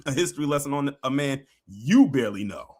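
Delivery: ordinary delivery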